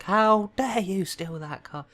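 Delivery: high, loud, very drawn-out mock outrage; outraged scolding. Reader imitating a later scolding, hammed-up outrage. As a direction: stretch it into a theatrical telling-off. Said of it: "I tried to say that like".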